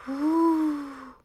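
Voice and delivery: high hollow wind sound; high whooshing wind; wind whoo. A narrator's reading. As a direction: blow like the autumn wind. Narrator imitates wind.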